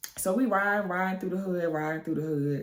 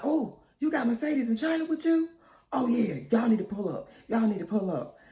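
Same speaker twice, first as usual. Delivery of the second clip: higher-pitched eager invitation; excited; 6 semitones higher than usual. Retold or imitated. Man hearing which girls are coming, excited. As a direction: perk up and urge them over. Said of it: "what you getting all excited for".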